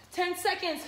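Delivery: normal talking voice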